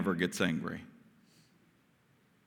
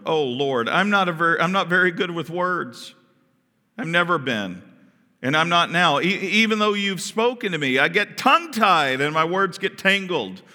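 higher, pleading with the Lord; mild plea, pitched up; 6 semitones higher, 4 dB louder than usual. Acted. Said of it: "Moses pleaded with the Lord".

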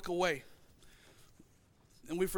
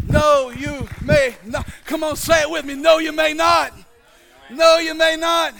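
loud, slow, emphatic pulpit refrain; full-force preaching shout; 6 semitones higher, 14 dB louder, slower than usual. Real, not acted. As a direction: shout it out so the congregation joins in. Preacher shouts refrain for congregation to repeat.

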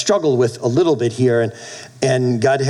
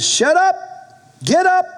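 commanding shout. Retold or imitated. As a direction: snap it out like a stern father. The Lord rebukes him.

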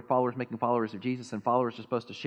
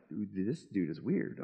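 low, soft and slow deadpan mutter; mock skeptical aside; 6 semitones lower, 8 dB quieter, much slower than usual. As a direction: drop the voice like an unimpressed listener. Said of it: "Some of you are like".

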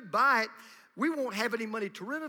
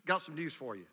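ordinary talk

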